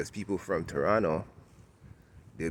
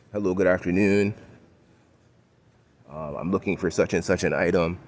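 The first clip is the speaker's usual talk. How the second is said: regular talk, polite. Acted. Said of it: "most people out here just talk regular".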